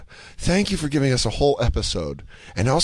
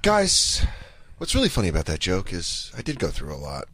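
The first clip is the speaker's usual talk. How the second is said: drops lower and softer into fake sincerity; a solemn comic getting real with the crowd, much lower; mock earnest comic; 8 semitones lower, 4 dB quieter than usual. Comic confessing to his audience, hushed and earnest. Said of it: "Yeah, I go".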